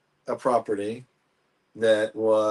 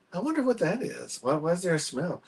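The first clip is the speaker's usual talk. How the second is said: mock wondering, pitched up high; puzzled client; 6 semitones higher, 4 dB quieter than usual. Realtor retells client wondering about the smell, puzzled and high-pitched. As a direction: imitate a puzzled client in a higher voice.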